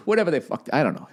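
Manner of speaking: ordinary talk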